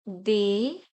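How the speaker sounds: D said as "day"; French D, "dé" with a pure vowel; French letter D. Shown as a demonstration. Teacher showing the French alphabet, D said "day". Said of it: "D is pronounced as".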